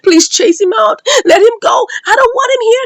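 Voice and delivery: high-pitched mock scream; half-screamed plea without full volume; retold scream. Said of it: "Bolaji was screaming".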